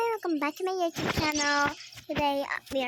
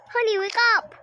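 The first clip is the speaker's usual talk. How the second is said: louder, higher wake-up shout; urgent shout; 7 semitones higher, 10 dB louder, faster than usual. Pretend. Mom shouts for her child to wake up.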